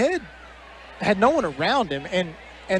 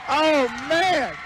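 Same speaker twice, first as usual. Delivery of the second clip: excited; 6 semitones higher than usual. Real, not acted. Reacting to game-winning shot.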